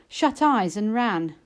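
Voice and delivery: plain reading voice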